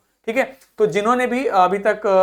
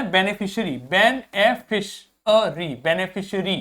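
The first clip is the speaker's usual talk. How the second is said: Beneficiary very slowly, be-ni-fi-shi-ree; slow pronunciation drill. Shown as a demonstration. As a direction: stretch beneficiary out for students. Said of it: "इसको क्या पढ़े". (Beneficiary धीरे-धीरे)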